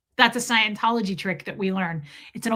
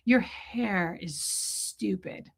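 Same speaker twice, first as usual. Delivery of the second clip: soft, slow insult; quiet slow jab; hushed quote; 3 semitones lower, 6 dB quieter, much slower than usual. Retold or imitated. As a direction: drop it low and slow. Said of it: "Doesn't she go".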